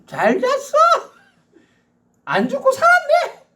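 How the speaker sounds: high, sneering "잘 잤어?" with a laugh; mock them with fake concern; sarcastic tone. Shown as a demonstration. Preacher demonstrating a sarcastic greeting, very high and sneering. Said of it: "비꼬는 말투로".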